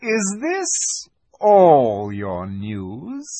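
very slow, drawn-out menacing question; icy queen's slow interrogation; cruel witch. A narrator's reading. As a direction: stretch each word, swooping up at the end.

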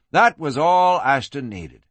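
ordinary delivery

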